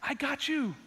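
reassuring God. Retold or imitated. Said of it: "and God goes".

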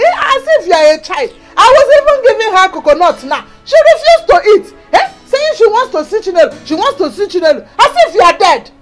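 loud, high, rapid complaint; exasperated scolding. Acted. Sister telling caller how worried Mama was, exasperated scolding.

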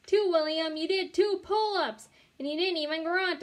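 high, loud thrilled cheer; thrilled holler. A narrator's reading. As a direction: cheer him on with joy. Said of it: "I hollered, really thrilled".

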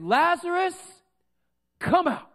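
high, loud, drawn-out summons; commanding shout, pitched high; loud calling out. Acted. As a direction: call into the tomb at full voice. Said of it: "Jesus called out with a loud voice".

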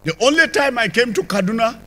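ordinary talk

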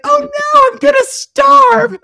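very high, loud wail breaking into laughter; hammed-up despairing cry; mock panic. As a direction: wail it as a joke. Acts out panic at imagined fate.

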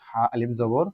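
ordinary talk

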